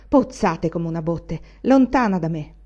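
ordinary delivery

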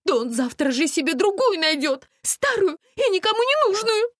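high, loud, aggrieved rant; hysterical tirade. A narrator's reading. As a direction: pour out grievances in a shrieky voice. Истерика Насти из-за мужчины.

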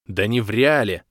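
a flash of annoyance; irritated. A narrator's reading. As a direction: snap back, annoyed. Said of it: "озлился я".

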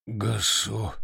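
rasping, faint cry of a wounded man; hoarse gasp. Acted. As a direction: wheeze it out weakly. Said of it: "прохрипел раненый".

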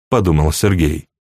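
plain reading voice

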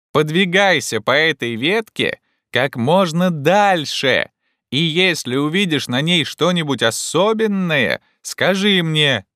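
slow, high, carrying instructions; agitated shout. A narrator's reading. Legrand orders Jupiter along the branch, shouting excitedly. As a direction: call it out with excitement. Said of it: "крикнул Легран с очевидным волнением".

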